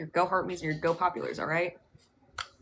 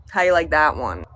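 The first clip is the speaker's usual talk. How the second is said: loud boast; 15 dB louder than usual. Real, not acted. Showing off after a burp.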